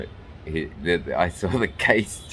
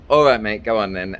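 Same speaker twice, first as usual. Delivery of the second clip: lightly imitated English accent; a hint of a casual English customs officer; 4 semitones higher, 6 dB louder than usual. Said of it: "in a very, very English accent".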